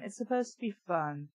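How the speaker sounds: ordinary talk, nothing marked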